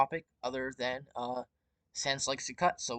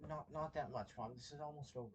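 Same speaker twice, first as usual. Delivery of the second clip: speaking softly away from the mic; quiet; 15 dB quieter, faster than usual. Real, not acted. Muted, answering mom off-mic.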